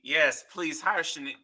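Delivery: ordinary talk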